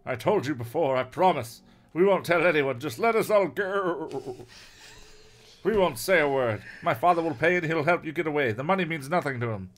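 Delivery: acted urgent plea; pleading captive. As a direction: bargain for your freedom. Captive boy begs for release.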